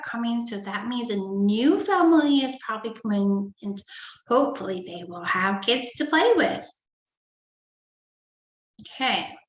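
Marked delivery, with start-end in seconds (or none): none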